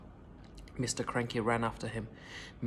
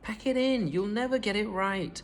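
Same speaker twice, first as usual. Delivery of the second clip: higher, sneering dismissal; scornful nagging; 7 semitones higher than usual. A narrator's reading. Mother mocking the failed medicine, higher sneer. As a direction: tell them to give up. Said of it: "Missus Cranky said".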